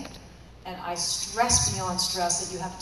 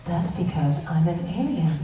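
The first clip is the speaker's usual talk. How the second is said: deadpan punchline; 3 semitones lower, 6 dB louder than usual. Retold or imitated. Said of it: "that's what I say".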